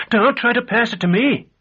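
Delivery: high, loud panicked squeak; frightened squeak. A narrator's reading. Shopkeeper squeaking at the hero to keep the stone away, high and panicked. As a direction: push the danger away in a squeal of fear. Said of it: "The shopkeeper squeaked".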